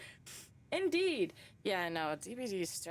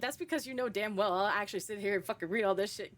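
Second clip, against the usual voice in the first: ordinary delivery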